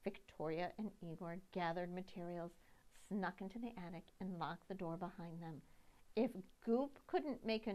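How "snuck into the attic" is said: ordinary delivery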